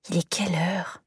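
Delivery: groggy whisper into the pillow; sleepy whisper. Acted. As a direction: mumble it half asleep. Half-asleep mumble in bed.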